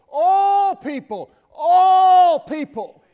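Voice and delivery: hammered all people, all people; building preacher shout. Acted. Preacher builds repeated phrase.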